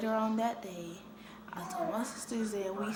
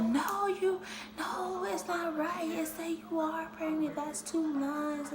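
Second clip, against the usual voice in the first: sister insisting; 7 semitones higher, slower than usual. Retold or imitated. Sister voiced correcting her.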